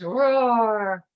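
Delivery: long loud growled roar; bear roar. A narrator's reading. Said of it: "the old bear growled".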